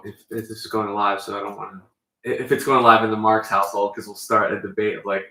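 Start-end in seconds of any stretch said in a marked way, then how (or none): none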